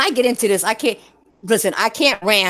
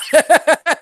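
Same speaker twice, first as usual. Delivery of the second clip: loud high laugh; 8 semitones higher, 5 dB louder than usual. Real, not acted. Laughing fit.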